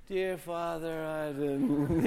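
mock half-asleep praying that trails away; sleepy prayer. Praying in bed after switching off alarm, mock drowsy mumble.

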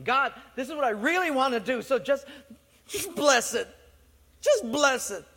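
whiny pleading. Acted. Flesh begging God to bless.

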